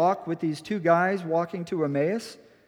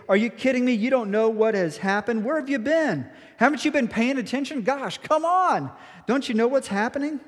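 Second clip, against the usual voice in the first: higher, mocking exasperation; exasperated disbelief; 4 semitones higher than usual. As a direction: roll your eyes at the stranger. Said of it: "in modern terms, they were saying".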